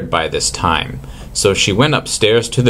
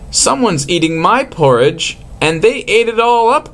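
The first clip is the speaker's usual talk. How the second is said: put-on high squeaky baby bear, rising higher; crying Baby Bear; 11 semitones higher than usual. A narrator's reading. Baby Bear complaining his porridge is gone, very high. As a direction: pitch it way up like a tiny upset cub. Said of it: "cried the Baby Bear".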